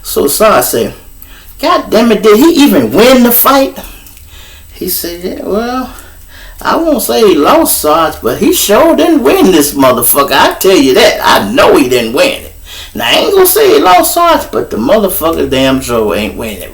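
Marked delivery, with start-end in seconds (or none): 1.6-3.8 high, eager exclamation; a sergeant itching to hear who won; curious sergeant. Retold or imitated. Sergeant asking his officer about the fight, high and eager. 5.2-16.7 high, hedging whine; a cop dodging admitting a loss; sheepish cop. Retold or imitated. Officer answering his sergeant, high and hedging.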